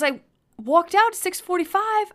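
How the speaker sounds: loud, high imitation of a defensive kid; indignant protest. Son defending himself to the parent, loud indignant protest.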